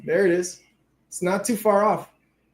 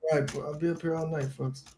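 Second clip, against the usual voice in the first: a softer, lower showman's sign-off; an old-school comic's low-key closing line; mock stage host winding down the night; lounge act emcee; 3 semitones lower, 11 dB quieter than usual. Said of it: "the inflection on your fucking voice".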